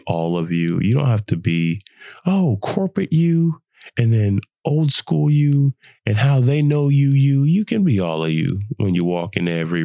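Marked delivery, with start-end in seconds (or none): none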